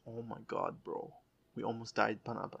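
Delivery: hushed, shaken retelling of relief; quiet stunned disbelief. Retold or imitated. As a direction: breathe it out like you just escaped death. Shaken after near crash.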